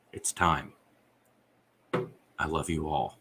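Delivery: low, softened last words; subdued, tender goodbye with pauses; quiet dying farewell. Retold or imitated. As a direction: gently act out letting go. Acting out dying father's farewell.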